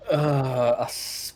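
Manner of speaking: ordinary delivery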